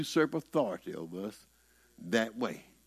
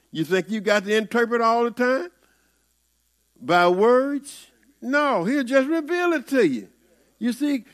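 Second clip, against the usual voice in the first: high, loud pulpit preaching, pressing the question; fiery sermon climax, loud and high; preaching at full force; 9 semitones higher, 6 dB louder than usual. Acted. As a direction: drive it home to the congregation, voice raised high.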